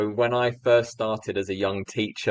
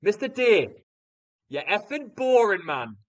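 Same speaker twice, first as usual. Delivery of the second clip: mock Sunderland shout, pitched way up; Mackem accent; 11 semitones higher than usual. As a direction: imitate a Mackem lad shouting from the back. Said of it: "in his Mackem accent, Sunderland accent".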